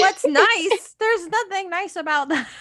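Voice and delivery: high, indignant dog voice; dog's voice. Retold or imitated. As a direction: speak for the startled dog. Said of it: "He said".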